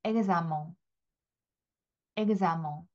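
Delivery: examen with a gz and nasal ending; French examen. Shown as a demonstration. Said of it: "프랑스어로는 이렇게 있습니다".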